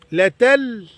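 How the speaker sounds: تل with a plain thin T instead of the heavy ط; wrong pronunciation. Shown as a demonstration. Teacher showing children's wrong طل, light T. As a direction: say تل with a light T, no emphasis. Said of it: "بيقولوا طل، غلط".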